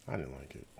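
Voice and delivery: low, muttered under the breath; soft, sulky grumble; quiet mutter. Real, not acted. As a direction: mutter it to yourself, deflated. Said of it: "I did not like it".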